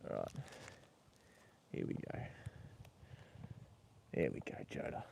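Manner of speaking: whispered, soothing the horse under the breath; barely voiced, calm murmur to the animal; soft whisper to horse. Real, not acted. Owner whispering to his horse Joda while bandaging, hushed.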